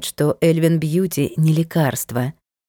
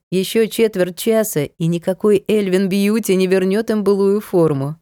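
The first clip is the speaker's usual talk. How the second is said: usual speaking voice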